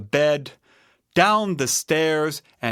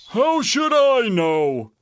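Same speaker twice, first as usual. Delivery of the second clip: raised, indignant bear voice; Papa Bear; 10 semitones higher, 4 dB louder than usual. A narrator's reading. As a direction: answer as an exasperated papa bear. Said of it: "said Papa Bear".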